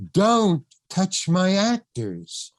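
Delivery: drawn-out order with a high, weighty start; John Huston's stern warning. Retold or imitated. Director ending the argument with casting director, slow emphatic warning. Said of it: "Just like that".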